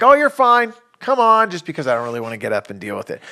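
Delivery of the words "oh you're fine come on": high, loud brush-off; dad calling out to a kid who took a slam; dismissive dad. Retold or imitated. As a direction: wave away the kid's fall. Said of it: "I'm like".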